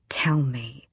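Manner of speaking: whispered, cold command; whispered demand. A narrator's reading. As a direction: breathe the order.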